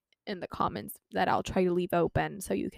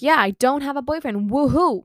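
higher, louder sarcastic woohoo; mock cheer; 5 semitones higher, 8 dB louder than usual. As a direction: cheer it out in fake celebration. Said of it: "Or like".

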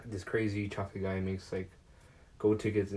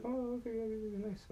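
much higher, softer mock teacher; 14 semitones higher, 5 dB quieter than usual. Teacher answering a classmate, in a high mock voice. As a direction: chirp it like a pleased teacher.

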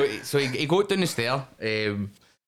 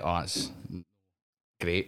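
sarcastic; 4 semitones lower, 7 dB quieter, slower than usual. Retold or imitated. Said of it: "now I'm kinda like".

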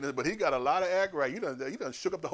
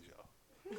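ordinary talk

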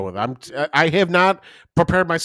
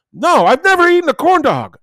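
put-on old man's denial; 13 semitones higher, 8 dB louder than usual. Old dad voiced denying.

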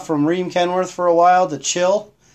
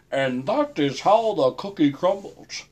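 slow, softer newsman sign-off; Walter Cronkite; 5 dB quieter than usual. Retold or imitated. For the chat, mock Walter Cronkite news sign-off. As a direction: intone it like a grave evening news anchor. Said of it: "do you want me to go Walter Cronkite?".

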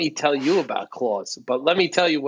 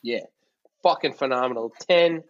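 ordinary talk

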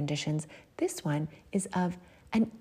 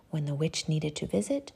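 ordinary delivery, nothing marked

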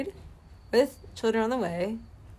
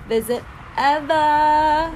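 loud, higher, drawn-out gush; 6 semitones higher, 7 dB louder, much slower than usual. Real, not acted. Raving about a weekend back at college, loud gush. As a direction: stretch it out, building towards song.

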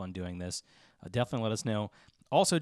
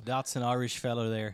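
mock Irish brogue; playful Irish local voice; Irish accent. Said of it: "How's my Irish accent?".

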